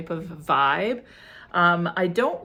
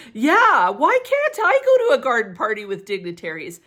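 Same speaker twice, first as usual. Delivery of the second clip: mock indignant; 6 semitones higher, 9 dB louder than usual. Complaining she can't attend party.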